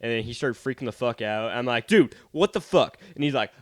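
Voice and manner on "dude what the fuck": retold yell. Kid yelling in playground fight.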